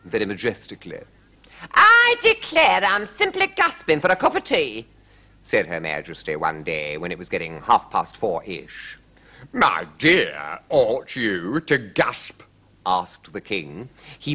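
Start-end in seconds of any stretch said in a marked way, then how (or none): 1.7-4.9 loud, very high queenly outburst; high-pitched dramatic queen; queen's declaration. A narrator's reading. Narrator voices gasping queen. 9.5-12.4 slow, drawn-out royal query; a hint of a pompous king; lightly voiced king. A narrator's reading.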